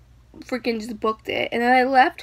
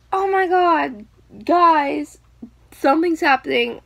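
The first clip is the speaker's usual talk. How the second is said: mock alarm, calling the group; retold scream; 3 semitones higher, 5 dB louder than usual. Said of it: "Corey screams".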